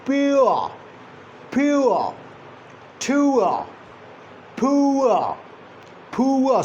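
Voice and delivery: "Pure" and "pua" gliding from "oo" to "uh"; gliding diphthong drill. Shown as a demonstration. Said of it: "movement from one position to another".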